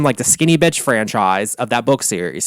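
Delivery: ordinary talk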